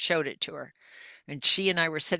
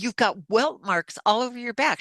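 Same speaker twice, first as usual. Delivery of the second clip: insistent alarm; 7 semitones higher, 5 dB louder than usual. Retold or imitated. Insisting to disbelieving sister.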